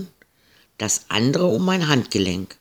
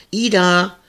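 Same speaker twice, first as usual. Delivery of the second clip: put-on high call across the yard, girl's voice; 7 semitones higher than usual. Marie calls to Ida on her way to the pond, raised voice. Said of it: "rief Marie".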